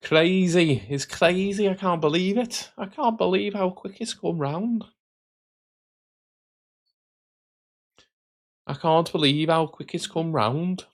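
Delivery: high-pitched mock Scouse; attempted Scouse accent. Streamer marvels at how quick it's come round, in attempted Scouse. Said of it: "that was my attempted, attempted Scouse accent there".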